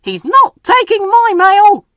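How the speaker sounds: high, loud squeaky peep; peeping Percy. A narrator's reading. Little engine protesting about his mail, high peep. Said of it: "peeped Percy".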